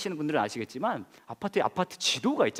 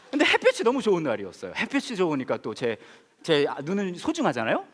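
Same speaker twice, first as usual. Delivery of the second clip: ordinary delivery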